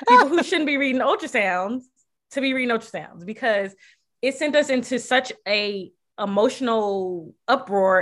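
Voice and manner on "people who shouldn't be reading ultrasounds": laughing through the words, pitched up and louder; amused disbelief spilling into laughter. Real, not acted. Mother telling friend about misread ultrasound, laughing.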